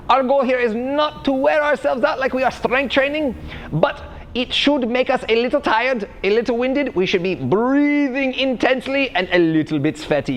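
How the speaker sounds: hammed-up German fitness coach explaining; the v for w, the j sounds, stern but cheery; fake German accent. Coach explaining warm-up theory to viewers, fake German accent.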